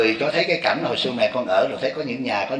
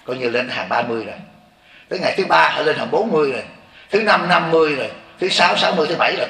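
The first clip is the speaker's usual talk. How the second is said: higher and louder, pressing each day home; fervent preaching; 4 semitones higher, 4 dB louder than usual. Acted. Monk preaching to listeners about the seven days, raised fervent voice. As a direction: count the days like a preacher driving a point.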